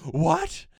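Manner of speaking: high, loud shocked yelp; shocked what. Retold or imitated. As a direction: squeal it in disbelief. Shocked reaction to reveal.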